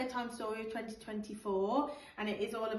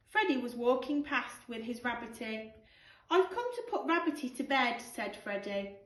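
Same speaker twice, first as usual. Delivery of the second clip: put-on lively storybook read-aloud for children; animated picture-book narration with character voices; bedtime story reading; 5 dB louder, slower than usual. Teacher reads bedtime story aloud.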